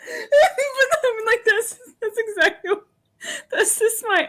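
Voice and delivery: high, loud laughing talk; laughing hard. Real, not acted. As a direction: crack up mid-sentence. Laughing hard while talking.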